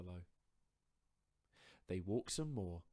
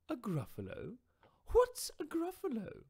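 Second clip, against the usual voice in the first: high, hooting owl voice, inviting; 11 dB louder than usual. Acted. Owl inviting the mouse for tea, high and hooting. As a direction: owl luring the mouse home.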